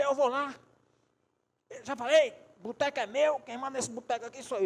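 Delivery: loud, high-pitched indignant retort; heated, belligerent insistence; acted angry outburst. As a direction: play a furious man insisting he's boss. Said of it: "foi brabo".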